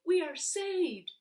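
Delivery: high, loud cry of relief; joyful shout. Acted. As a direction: shout it like a saved child. Children rescued, shouting joy.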